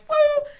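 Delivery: high, loud "woo"; anxiety whoop. Retold or imitated. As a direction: imitate anxiety shooting up with a whoop. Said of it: "My anxiety goes like".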